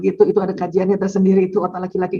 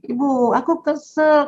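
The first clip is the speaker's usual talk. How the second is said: put-on 1 childlike complaint; 6 semitones higher, slower than usual. Child telling mother feelings.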